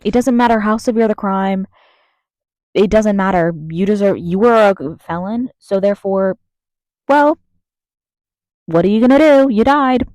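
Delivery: loud, furious rant, voice rising to a shout; angry yelling. Real, not acted. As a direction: yell it out in real anger. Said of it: "yelling at myself because I'm angry".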